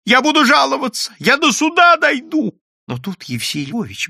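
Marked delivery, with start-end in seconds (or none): 0.1-2.6 very high, loud threats; shouting. A narrator's reading. Clerk threatening to go to court, shouting high. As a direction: yell your protest. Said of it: "закричал Прозрачный".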